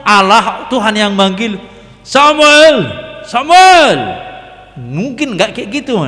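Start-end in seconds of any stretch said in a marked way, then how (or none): 2.0-4.0 loud, angry shout of the name; angry call. Shown as a demonstration. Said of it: "itu marah". (panggilan keras dan marah)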